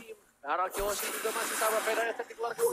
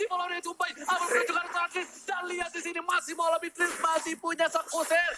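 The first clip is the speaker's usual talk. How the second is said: hyped play-by-play, higher and faster; fighting game announcer; 6 semitones higher than usual. Real, not acted. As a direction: call the clash like a fight announcer.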